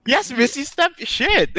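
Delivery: high-pitched mock excitement; imitating eager asking. Game master teasing how player asked, mock-eager. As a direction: squeal it, teasing the other player. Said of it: "I like how you asked".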